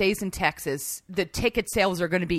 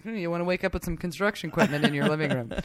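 sly vodka voice; 3 semitones lower, 3 dB quieter, faster than usual. Retold or imitated. Voicing vodka's suggestion.

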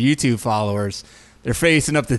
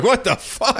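mock shock; 9 semitones higher than usual. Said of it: "you're like".

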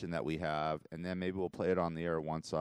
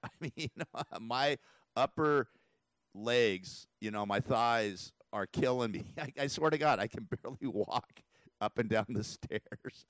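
laughing through the words; 4 semitones higher than usual. Real, not acted. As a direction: chuckle while complaining about sore legs. Laughing about sore legs.

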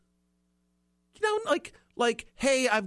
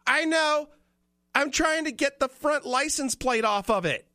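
woman's retort; 10 semitones higher than usual. Retold or imitated. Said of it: "She goes".

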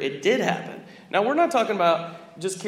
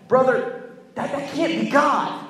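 pleading; 6 semitones higher, 3 dB louder, slower than usual. Retold or imitated. Pleading with pastor.

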